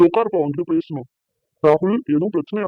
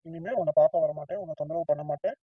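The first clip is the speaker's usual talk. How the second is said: fading, much softer toward the end; quiet sulky promise; 2 semitones lower, 7 dB quieter than usual. Acted. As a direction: let it trail off, hurt. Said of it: "ப்ராமிஸா சொல்றேன்". (மெதுவாக, மனம் நொந்து சத்தியம் செய்வது)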